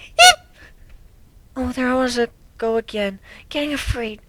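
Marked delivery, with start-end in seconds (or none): 0.1-0.5 high frightened squeak; a tiny, high terrified squeak; startled eep. Acted. Timid pony squeaking in fright at the dog. Scared by the predator's eyes.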